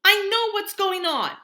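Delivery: high, loud, strained shriek. Acted. Augie shrieks at Mom, feeling babied. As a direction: scream it through tears of rage.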